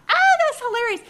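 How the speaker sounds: high, loud laugh-filled exclamation. Retold or imitated. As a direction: burst out laughing on it. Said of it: "I just burst out laughing".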